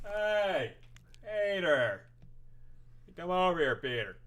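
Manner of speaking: loud, high cop voice calling out; Joe from Family Guy. Retold or imitated. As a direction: bark it out like Joe Swanson. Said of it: "we'll do Joe from Family Guy".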